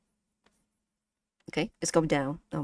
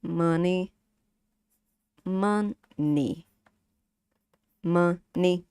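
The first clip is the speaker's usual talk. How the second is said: "money" slow and level, syllables held; flat tone; much slower than usual. Shown as a demonstration. Teacher demonstrating flat marks, level drawn-out "money". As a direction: hold "money" evenly on one note. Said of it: "ni, flat".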